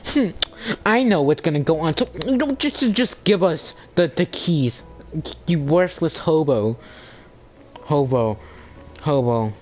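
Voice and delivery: high, smug know-it-all voice; mocking Missy. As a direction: mimic her high, bossy know-it-all whine. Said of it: "she acts as if her squeaky ass voice".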